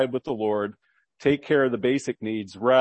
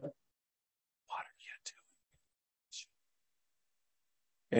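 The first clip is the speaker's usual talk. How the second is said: slow hushed whisper; a still small whispered voice; 15 dB quieter, much slower than usual. Acted. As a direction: barely breathe the question.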